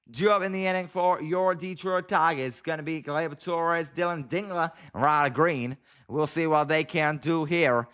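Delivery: game commentary in a put-on American accent; bad American accent. Shown as a demonstration. Commentator introducing the Detroit batters, badly faked American accent. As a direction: announce the batters in a shaky attempt at American. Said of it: "that's all you get from me in an American accent".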